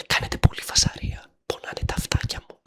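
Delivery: mock whisper about sore little ears. Vlogger hushing noisy viewers, playful mock whisper. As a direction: whisper it as if the noise hurts. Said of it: "μη φωνάζεις".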